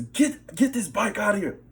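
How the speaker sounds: a worker yelling at the narrator while grabbing his bike. Retold or imitated. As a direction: screech the order. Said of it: "he's yelling and screaming".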